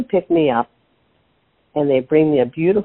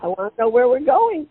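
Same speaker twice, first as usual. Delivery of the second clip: a higher, mildly frightened plea; half-mock panic to be let out; scared plea; 10 semitones higher than usual. Mom in the back seat begs her sons, mildly scared. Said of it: "And I got scared".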